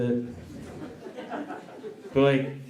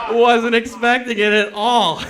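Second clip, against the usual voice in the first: high laughing, slow; 8 semitones higher, 5 dB louder than usual. Real, not acted. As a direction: squeeze it out laughing. Said through laughter.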